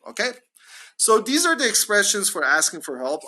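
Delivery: ordinary talk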